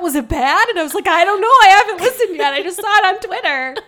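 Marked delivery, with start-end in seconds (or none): 0.0-0.7 retold alarm. Retold friend's worried question. 1.1-3.8 loud, high mock panic; retold fluster. Retold flustered reply to friend.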